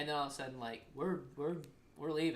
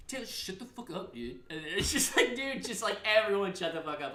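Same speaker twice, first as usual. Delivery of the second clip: higher-pitched mimicked shut-up; exasperated; 6 semitones higher, 4 dB louder than usual. Passenger telling the squabbling family to quit, mock-exasperated. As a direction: imitate someone fed up with the bickering. Voicing exasperated people in the car.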